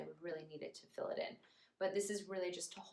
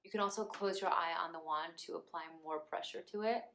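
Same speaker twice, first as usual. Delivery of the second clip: normal talking voice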